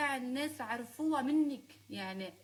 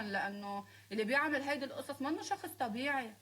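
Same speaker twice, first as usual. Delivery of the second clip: ordinary talk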